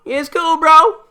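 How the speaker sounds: high mock surfer-bro voice, each cool lifted up.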